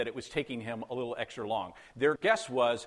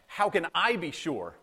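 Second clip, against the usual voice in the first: "I" drawn out, higher and louder; stress on I; 4 semitones higher, 4 dB louder than usual. Retold or imitated. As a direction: lean hard and slowly on "I". Contrasts Zechariah's question with Mary's.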